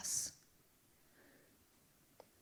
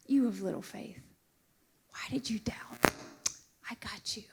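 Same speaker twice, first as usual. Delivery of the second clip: hushed, tender reassurance; tender Jesus; 6 dB quieter than usual. Acted. Jesus reassuring a sinking Peter, hushed and tender. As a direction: whisper it gently like a loving father.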